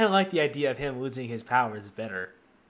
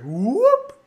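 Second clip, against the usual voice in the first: Robin Williams whoop; 11 semitones higher, 8 dB louder than usual. Retold or imitated. Robin Williams Pinocchio bit.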